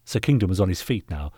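usual speaking voice

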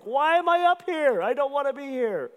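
very high, loud mock whine; retold tearful protest, hammed up and high-pitched; embarrassed wail. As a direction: wail in comic mortification. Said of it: "I'm embarrassed".

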